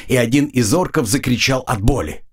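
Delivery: usual speaking voice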